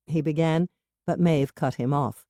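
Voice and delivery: usual speaking voice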